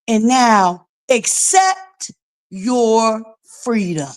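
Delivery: slow, spelled-out reading for slow listeners; patient, deliberate reading; slowly. Shown as a demonstration. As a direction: read it slow for the people in the back. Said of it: "let me read it for you slow".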